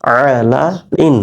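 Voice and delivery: আলা ইন drawn out very slowly; slow teacher demo; slow modeled reading. Shown as a demonstration. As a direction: stretch আলা ইন out slowly so students can follow. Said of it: "উচ্চারণ হবে".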